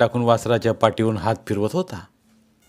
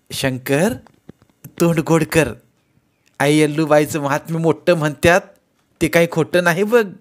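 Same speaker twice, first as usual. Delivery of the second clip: higher, louder village mother's voice, bantering; teasing old mother; 5 semitones higher, 4 dB louder than usual. Acted. Mother teasing her son, higher and louder. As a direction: rib him about finding gold.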